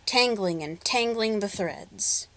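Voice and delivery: ordinary delivery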